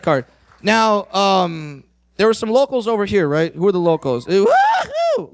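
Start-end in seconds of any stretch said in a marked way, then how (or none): 4.3-5.3 high stretched whoop; mock cheer. Comedian cheering for the locals, high whoop.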